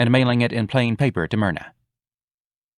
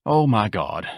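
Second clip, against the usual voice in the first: sighing; slower than usual. Acted. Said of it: "Ignatius sighed".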